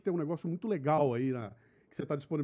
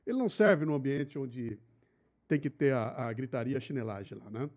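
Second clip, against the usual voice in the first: ordinary talk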